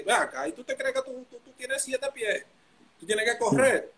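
high mock scolding coach voice. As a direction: lightly play the coach telling him off. Coach scolding him, retold.

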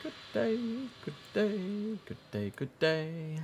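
high, drawn-out sing-song farewell. Pretend. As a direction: chirp it back playfully up high. Mock-sing reply to pilot.

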